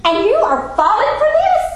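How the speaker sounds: shocked as if you can't believe it; incredulous inner voice. Retold or imitated. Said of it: "I'm thinking".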